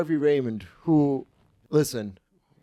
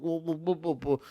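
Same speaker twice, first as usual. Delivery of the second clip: soft mock British accent, quieter; imitating a British girl's whoa, softly; 3 semitones higher, 6 dB quieter than usual. Mocking a British performer with a soft British accent. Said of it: "That's my British accent".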